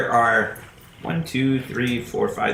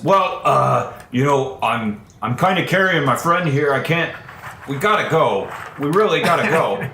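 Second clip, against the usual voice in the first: flustered pleading; 3 semitones higher than usual. Acted. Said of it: "He says".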